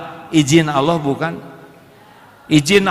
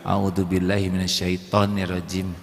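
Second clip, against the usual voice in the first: softly; 8 semitones lower, 6 dB quieter than usual. Shown as a demonstration. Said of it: "Pelan, ya".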